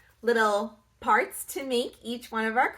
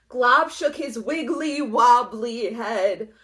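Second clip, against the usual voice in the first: usual speaking voice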